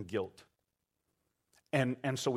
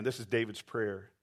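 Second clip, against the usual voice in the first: ordinary talk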